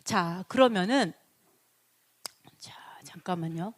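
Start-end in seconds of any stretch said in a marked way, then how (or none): 2.6-3.7 breathy whisper to himself; 혼잣말 속삭임; whispered aside. Real, not acted. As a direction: drop to a whisper, off-mic. Aside while fixing slides.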